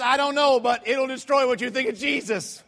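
high, loud stubborn retort; mock drunk stranger. Stranger admitting ignorance to preacher, loudly defiant. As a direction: insist loudly like a heckler, acted out. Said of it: "I got a drunk on my hand here".

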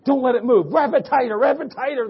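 high, loud urgent sideline orders; coach crying out. Retold or imitated. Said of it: "the coach is crying out".

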